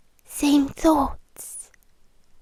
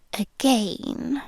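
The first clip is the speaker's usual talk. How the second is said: ordinary talk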